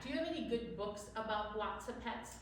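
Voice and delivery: usual speaking voice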